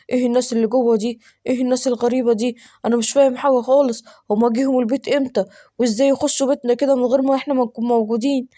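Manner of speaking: ordinary talk, nothing marked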